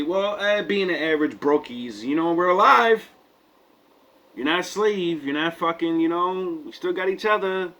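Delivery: high-pitched rallying reassurance; acted consolation. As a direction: lift the pitch to voice folks counting their blessings. Acted quote of resilient community.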